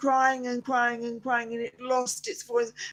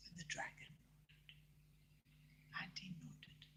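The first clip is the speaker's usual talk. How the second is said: soft bedtime whisper; whispered narration; 4 semitones lower, 24 dB quieter than usual. A narrator's reading. Grandma whispering the story to a child. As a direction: hush the room as you tell it.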